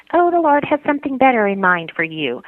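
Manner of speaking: high-pitched imitation of pious consolers; mock platitude. Imitating people consoling her after disappointment, mock platitude. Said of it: "all people had to offer were platitudes".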